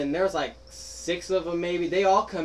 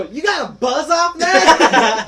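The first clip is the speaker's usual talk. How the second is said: high, loud, slow disbelieving yell; incredulous shout; 10 semitones higher, 12 dB louder, slower than usual. Retold or imitated. Girl asking the prank victim, shouting in disbelief. Girl's incredulous question retold.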